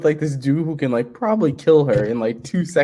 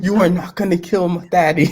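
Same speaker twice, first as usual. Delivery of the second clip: high-pitched, hammed-up protest; mock defiance in a raised voice; Jo's protest; 8 semitones higher than usual. Fan acting out Jo defending her daddy, high mock protest. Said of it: "And then Jo's like".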